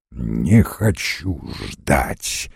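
very low, slow menacing voice; sinister stranger. A narrator's reading. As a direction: refuse coldly, slow and deep. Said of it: "отвечал незнакомец".

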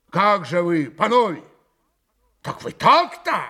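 loud, high, affronted challenge; возмущённый упрёк. A narrator's reading. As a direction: call them out for refusing. Mitya indignant at the refused toast. (громко, с возмущением)

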